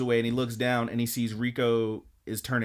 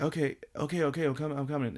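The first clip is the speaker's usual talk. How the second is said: put-on flustered boy; 3 semitones higher, faster than usual. Boy hurrying after bunny, retold.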